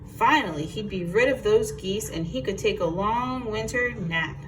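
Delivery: ordinary delivery, nothing marked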